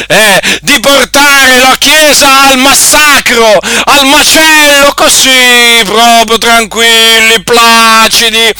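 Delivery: high, loud pulpit denunciation; fiery preaching. Acted. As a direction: thunder at them from the pulpit. Preacher denouncing the congregation.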